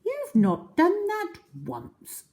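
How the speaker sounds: high, slow, scoffing; scornful small croc. Acted. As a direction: snort with scorn. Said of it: "snorted the not so big one".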